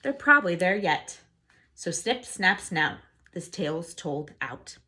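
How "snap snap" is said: plain reading voice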